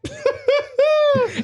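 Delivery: long, high squealing laugh; real breathless giggle fit; helpless laughter. Real, not acted. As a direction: lose it completely over your bad accent. Cracks up again mid attempt.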